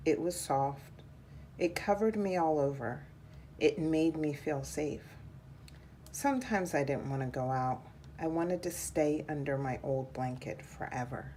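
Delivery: ordinary delivery, nothing marked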